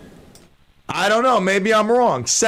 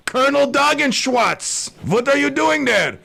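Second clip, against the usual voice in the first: Putin; 7 semitones higher than usual. Acted. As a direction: act out a surprised leader greeting his colonel, pitched up. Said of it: "and he's like".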